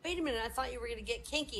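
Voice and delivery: quick, high impatient line; fast, impatient. Acted. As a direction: rattle it off impatiently. Girl impatient.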